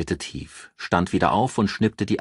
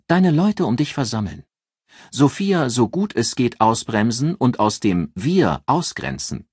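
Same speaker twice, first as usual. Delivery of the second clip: higher, louder assured female voice; raised voice for a calculating friend giving advice; Ann, cool strategist; 4 semitones higher, 4 dB louder than usual. Acted. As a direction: lay out the plan like a cool strategist. Ann advising Henri.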